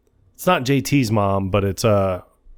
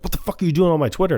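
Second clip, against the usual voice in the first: fast, much higher retold outburst; mock outrage; 7 semitones higher, much faster than usual. Boss confronts the impostor, mock angry outburst. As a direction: fire it off in hammed-up disbelief.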